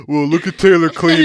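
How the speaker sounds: mock the excited viewers; lightly voiced gawking commenters; stream commenters. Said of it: "all my comments will be like".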